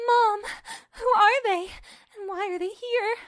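high, loud, wailing melodrama; overacted tearful cry of disbelief; overdramatic. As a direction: ham it up to the rafters. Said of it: "or just over dramatic".

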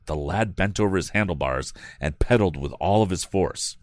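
ordinary delivery

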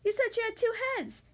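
put-on high-pitched young whine of complaint; complaining. A narrator's reading. Young kangaroo let down by the act, complaining. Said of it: "complained the young kangaroo".

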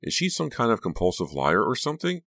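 high, incredulous questioning; indignant interruption. A narrator's reading. Becca questions Honey's honesty to Trish, indignant. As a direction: press on, outraged and lifted in pitch. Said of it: "Becca interrupted".

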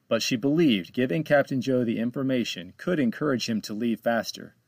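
ordinary delivery, nothing marked

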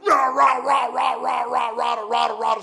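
loud high shouted rah rah; imitated raging gibberish. Imitating the gamer's raging gibberish yelling. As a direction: bark out rah rah like a cartoon monster. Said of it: "Sound like that thing from, uh, Chowder".

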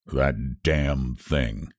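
slow, husky, unnatural voice. A narrator's reading. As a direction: rasp it out slowly, husky and strained. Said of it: "His voice was husky and unnatural".

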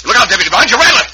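shouted false warning, high and loud; sudden high yell of mock alarm; fake alarm shout. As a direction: yell it like a real snake scare to trick him. Fake warning to distract sheriff.

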